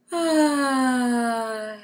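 drawn-out settling "ah"; meditative sighed "ah", gently falling; long relaxed "ah". Shown as a demonstration. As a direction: let out one long, steady "ah". Demonstrates a meditative settling sound.

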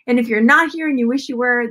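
usual speaking voice

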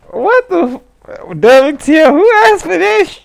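high, incredulous what-the-hell outburst; outraged disbelief. Retold or imitated. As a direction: react like you can't believe it. Outraged reaction to remake news.

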